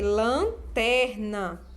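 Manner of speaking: Lanterna very slow, "lan", "ter", "na"; syllable by syllable. Shown as a demonstration. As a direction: break Lanterna into three held syllables. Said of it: "trabalhando aqui as sílabas".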